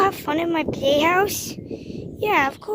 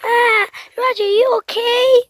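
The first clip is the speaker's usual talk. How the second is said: higher, louder worried calls; higher, louder urgent cry; urgent alarm; 6 semitones higher, 4 dB louder than usual. Pretend. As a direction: shout to save Roger. Parrot attacks, friend worries.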